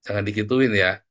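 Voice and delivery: ordinary delivery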